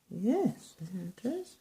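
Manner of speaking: plain reading voice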